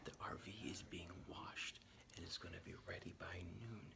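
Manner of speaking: secret hushed whisper; whispering while hiding. Real, not acted. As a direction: keep it to a whisper. Sneaking down to surprise kids.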